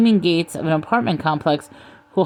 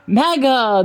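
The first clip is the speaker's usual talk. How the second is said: higher-pitched, drawn-out war cry; shriek; 7 semitones higher than usual. A narrator's reading. Masked pack attacking from the darkness, mock shriek. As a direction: cry the slogan like an attacking mob. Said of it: "they shrieked".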